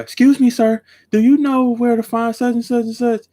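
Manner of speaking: older lady asks the manager for directions, high put-on voice. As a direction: play a fussy older customer asking for help.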